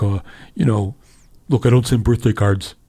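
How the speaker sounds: lightly voiced grumpy old senator; slight impression of a blunt old politician; a hint of a put-on curmudgeon voice; Bernie. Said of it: "You do a better Bernie voice".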